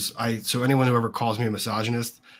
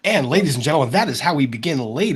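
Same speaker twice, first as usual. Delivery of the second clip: announcer voice; 4 semitones higher, 6 dB louder than usual. Acted. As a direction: boom it out like a showman. Opening the show like a host.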